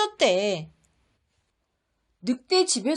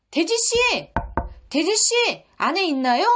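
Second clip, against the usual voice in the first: calling out; 4 semitones higher than usual. Acted. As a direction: call out to the pig inside. Wolf calls to pig.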